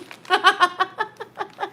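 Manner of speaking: high, helpless real laughter; cracking up. Real, not acted. Cracking up over the mailed bird, helpless laughter. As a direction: burst into a fit of high giggles. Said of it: "I'm cracking up".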